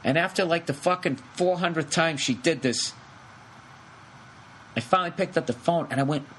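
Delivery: usual speaking voice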